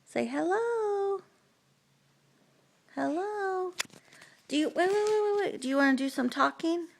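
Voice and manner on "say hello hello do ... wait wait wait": put-on high, soft sing-song baby talk; reading to a toddler. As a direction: coo softly to a little child.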